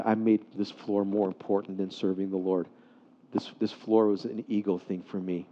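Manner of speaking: low, subdued owning-up; retold tearful confession. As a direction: quietly admit the fault, no sobs. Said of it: "he began to cry".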